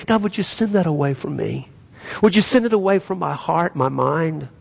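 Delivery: earnest spoken prayer to God. Shown as a demonstration. Pastor modelling a confession prayer, earnest prayer. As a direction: pray it aloud as a model for the congregation.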